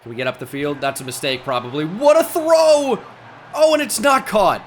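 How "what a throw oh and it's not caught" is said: high, loud, stretched yell that sinks to dismay; real excitement turning to letdown; excited shout. Real, not acted. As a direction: cheer the throw then groan as it's dropped. Big throw, then dropped.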